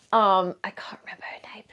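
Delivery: hushed whisper while searching for a name; quiet whispered aside mid-thought. Real, not acted. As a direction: drop to a whisper as if thinking aloud.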